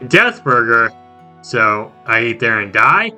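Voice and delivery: ordinary talk, nothing marked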